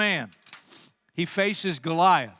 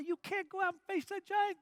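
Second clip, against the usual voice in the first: mocking doubter; 12 semitones higher, 8 dB quieter than usual. Voicing doubters mocking young David.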